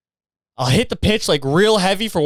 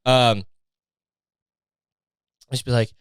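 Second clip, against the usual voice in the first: ordinary talk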